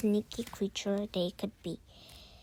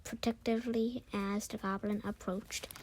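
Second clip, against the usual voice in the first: ordinary delivery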